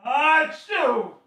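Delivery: high loud sneeze. Real, not acted. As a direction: sneeze loudly.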